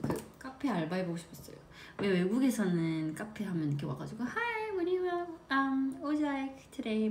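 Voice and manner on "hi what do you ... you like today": high, slow friendly barista greeting; sing-song English cafe server. Retold or imitated. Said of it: "막 이렇게 하잖아요".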